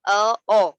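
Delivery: अ moving to ओ, said loud and rounded; o coloring. Shown as a demonstration. Teacher shows students adding an o sound to heavy letters. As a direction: slide from अ into a rounded ओ. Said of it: "थोड़ी सी ओ की sound लगाएंगे".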